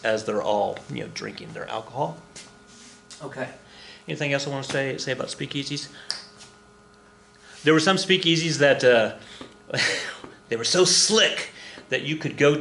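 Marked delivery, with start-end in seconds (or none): none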